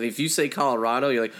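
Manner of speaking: ordinary talk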